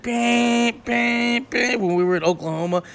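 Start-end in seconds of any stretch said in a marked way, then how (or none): 0.0-1.8 imitated car horn. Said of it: "He's like".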